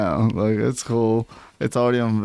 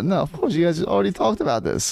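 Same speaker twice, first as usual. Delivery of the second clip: ordinary delivery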